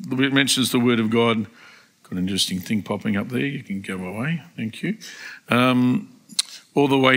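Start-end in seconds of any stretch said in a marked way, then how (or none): 2.0-5.0 low, hushed, quick aside; quiet aside. Real, not acted. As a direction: drop your voice to deal with a small interruption. Aside about screen pop-up.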